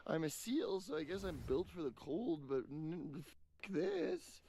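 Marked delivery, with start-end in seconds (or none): 3.6-4.4 frustrated outburst. Real, not acted. Cursing the cold.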